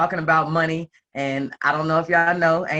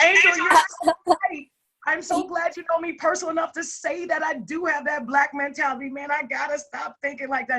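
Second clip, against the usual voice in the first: hammed-up gushing agreement; 11 semitones higher than usual. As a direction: overplay your delighted agreement, pitched way up. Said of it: "her accent's so thick. So I thought she said".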